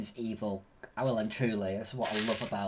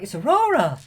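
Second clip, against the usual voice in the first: excited king; 9 semitones higher, 8 dB louder than usual. Retold or imitated. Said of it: "Stefan's like".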